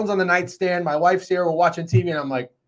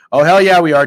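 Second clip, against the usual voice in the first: loud hyped echo; 8 dB louder than usual. Retold or imitated. Repeating attorney's hyped reply.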